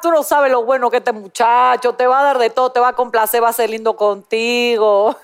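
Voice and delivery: Dominican accent. Shown as a demonstration. Host demoing a Dominican "llame ya" ad. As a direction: pitch the little guy in Dominican Spanish. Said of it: "A ver, ven-, vendan".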